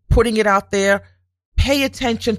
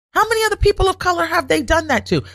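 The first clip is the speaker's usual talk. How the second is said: outraged rhetorical question, much higher; indignant higher repeat; 11 semitones higher, faster than usual. Real, not acted. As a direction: pitch jumps up on the repeat. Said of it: "it came to me".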